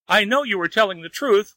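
high-pitched wail. A narrator's reading. Wang admits to the fairy he is lazy, wailing. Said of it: "wailed Wang".

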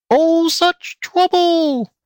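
very high, slow pig squeal; squealing pig lady. A narrator's reading. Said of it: "squealed the pig lady".

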